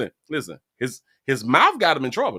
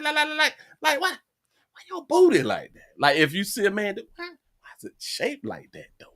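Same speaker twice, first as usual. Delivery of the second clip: mock baffled man; 9 semitones higher than usual. Man reacting to a bad BBL, mock baffled and high-pitched. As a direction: act out a confused guy staring.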